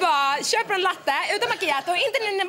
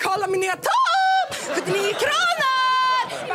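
high squeal with stretched endings; gushing show-off; 8 semitones higher, slower than usual. Acted. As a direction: brag to friends in hammed-up delight. Acts out showing off a bargain.

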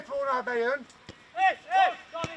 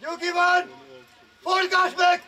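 shouted coaching; 2 semitones higher than usual. Real, not acted. Coach steers the play, shouting. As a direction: call it out to distant players.

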